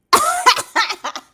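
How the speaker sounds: high, loud burst of laughter. Real, not acted. Host laughing at chat saying she was crying, loud laugh. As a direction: let a big laugh out.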